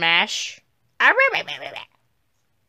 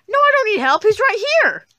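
high-pitched shouted protest; loud, squealing denial; frantic insistence; 13 semitones higher, 7 dB louder than usual. Acted. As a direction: yell back, desperate to be believed. Insists against a doubting friend.

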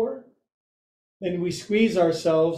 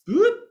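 high playful whoop; 4 dB louder than usual. Acted. Playful cue mid-pose.

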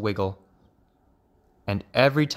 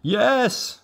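alarmed father frog; 11 semitones higher than usual. A narrator's reading.

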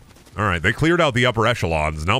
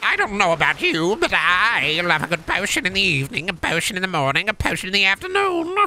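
higher and higher wizard voice; 9 semitones higher than usual. Acted. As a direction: wizard voice climbing to a squeak. Wizard voice rising to a squeak.

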